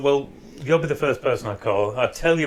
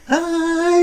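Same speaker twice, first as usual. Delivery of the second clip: high, excited greeting; an overjoyed, eager hello; 4 dB louder than usual. Retold or imitated. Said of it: "He's really excited to see him".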